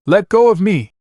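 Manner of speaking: high, loud yell, go punched hard. Acted. Anna to Naruto, finally yelling. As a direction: finally explode on go. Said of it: "she finally yelled".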